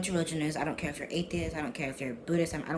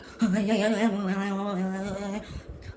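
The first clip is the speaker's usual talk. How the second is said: wordless wavering chant, higher and louder; mock cult chanting; 5 semitones higher, 5 dB louder than usual. Vlogger mimicking cults at prayer, mock chanting. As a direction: babble like a cult in mid-ritual. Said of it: "how cults be like".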